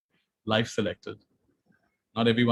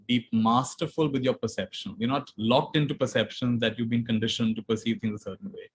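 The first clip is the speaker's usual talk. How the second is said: usual speaking voice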